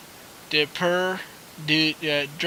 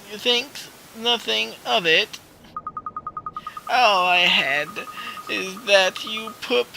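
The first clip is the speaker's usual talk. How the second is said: put-on high, quavery old woman; old lady voice; 5 semitones higher than usual. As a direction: play a sweet old shop lady. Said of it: "how you like my old lady voice".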